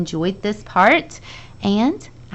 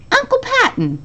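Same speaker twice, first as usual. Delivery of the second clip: high startled cry of the name; in surprise. A narrator's reading. Charlie surprised to see Uncle Patton in the rain. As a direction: caught off guard by his uncle. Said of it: "he said in surprise".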